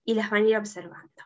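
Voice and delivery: ordinary talk, nothing marked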